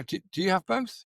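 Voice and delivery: ordinary talk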